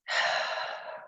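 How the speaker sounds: long, unvoiced exhale; deep sigh. Shown as a demonstration. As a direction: let a deep breath out audibly.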